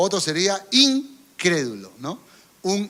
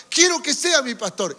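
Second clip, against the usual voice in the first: higher pleading voice of a tearful man; mimicked crying plea; 7 semitones higher, 4 dB louder than usual. Man weeping to his pastor, pleading higher. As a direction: beg like a weeping church member. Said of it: "seguía llorando diciendo".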